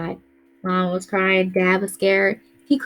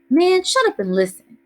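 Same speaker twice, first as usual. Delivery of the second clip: a high, loud big-brother snap; older brother silencing his whining kid brother, loud; sharp order; 11 semitones higher, 6 dB louder than usual. A narrator's reading. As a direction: cut him off hard. Big brother snaps at Kenny.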